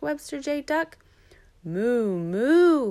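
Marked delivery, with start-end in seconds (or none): 1.6-2.9 long moo swelling louder and higher; cow moo. A narrator's reading. Bigger big duck answers Webster, swelling moo. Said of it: "went the bigger big duck".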